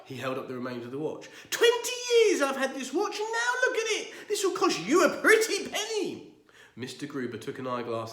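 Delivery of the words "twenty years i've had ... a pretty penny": furious rant. Acted. Enraged neighbour ranting.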